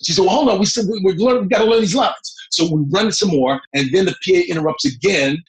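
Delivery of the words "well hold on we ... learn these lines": higher-pitched brusque rebuff; Kathy Bates. Retold or imitated. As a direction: put off the assistant insistently, pitched up. Acts out Kathy rebuffing assistant.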